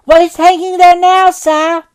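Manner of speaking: put-on high, bright young lad's voice; a cocky boy calling up to the window; cheeky street boy. Boy replies.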